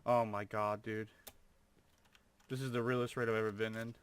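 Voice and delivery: ordinary talk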